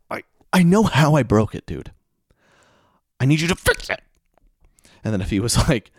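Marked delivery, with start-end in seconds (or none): 0.1-4.0 high, loud, fed-up protest; comic exasperation, pitched up; exasperated outburst. Acted. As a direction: lose patience with a useless explanation. Exasperated patient answering doctor.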